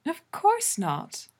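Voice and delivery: high, drawn-out bright reassurance; bright amusement. A narrator's reading. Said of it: "Allura laughs, bright".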